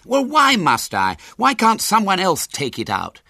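put-on high, loud, indignant boy arguing; passionate protest. A narrator's reading. Henry arguing with his mother in the garden, passionate protest. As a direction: argue back loudly, voice pitched right up. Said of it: "raised in passionate altercation with his mother".

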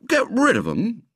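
high-pitched indignant schoolboy echo. A narrator's reading. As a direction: throw it back as an outraged question. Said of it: "said William indignantly".

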